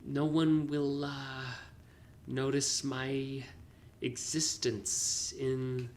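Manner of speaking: saying it weird. Retold or imitated. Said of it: "That's why I'm saying it weird".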